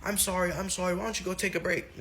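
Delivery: high, soft, flustered apology; teacher apologizing to the crying boy, high and soft; apologetic teacher. Retold or imitated. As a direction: soothe the crying kid quickly. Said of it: "Teacher's like".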